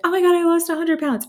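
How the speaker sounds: high, loud and fast retelling of the message; all caps excitement. Retold or imitated. Retelling her text to the doctor, excited rush. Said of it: "I sent her an all caps message".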